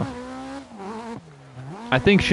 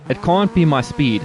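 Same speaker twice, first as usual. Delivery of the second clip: ordinary talk, nothing marked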